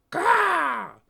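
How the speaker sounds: high, loud raven squawk; sharp bird call; raven caw. Acted. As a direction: caw like a crow. Raven Anna caws.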